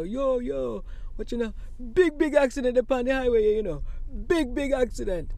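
put-on Jamaican accent. Passer-by on highway shouting about accident, Jamaican accent. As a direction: Jamaican accent, loud and quick. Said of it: "I heard a Jamaican accent".

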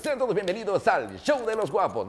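presenter greeting the audience; announcer voice. Shown as a demonstration. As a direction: open the show like a TV host. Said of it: "mi tono de voz bien particular".

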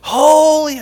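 high, loud, held cry; ringing shout of praise; screaming declaration. Acted. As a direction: answer like a seraph at full voice. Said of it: "by screaming and declaring it out".

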